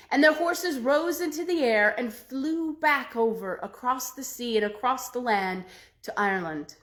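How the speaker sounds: ordinary delivery, nothing marked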